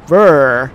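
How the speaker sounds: loud cold shiver sound; shivering brr. Acted. Shivering at the thought of the couch, loud brr.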